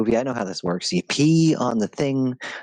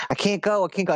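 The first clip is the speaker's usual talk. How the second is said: mock panic; 9 semitones higher, faster than usual. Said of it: "you know, like".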